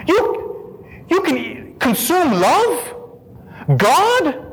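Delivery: high, halting questions of astonishment; overwhelmed, wondering retelling; incredulous awe. Retold or imitated. Retelling his disbelief at communion.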